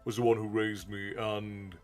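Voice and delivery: ordinary talk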